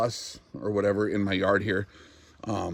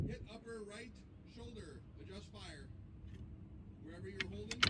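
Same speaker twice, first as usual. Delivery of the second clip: calm radio call for fire; 12 semitones higher, 9 dB quieter than usual. Real, not acted. Spotter calling corrections to shooter.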